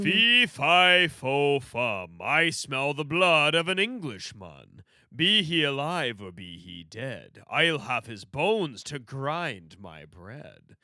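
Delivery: slow, booming giant chant. Pretend. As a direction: boom it out like a giant. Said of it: "my giant voice".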